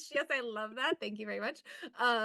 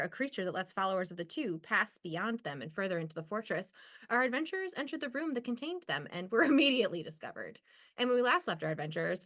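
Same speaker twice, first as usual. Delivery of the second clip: storyteller recap read; recap narration; 3 semitones higher than usual. Acted. Player recaps last session for the table, narrator read. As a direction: read it like a previously-on intro.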